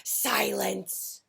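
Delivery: mild bear roar. A narrator's reading. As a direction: bark out a bear's roar. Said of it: "Bear roared".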